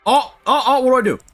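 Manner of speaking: high, flustered alarm; startled and high-pitched; panicked. Real, not acted. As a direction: blurt it out in a sudden panic. Panics as a threat appears.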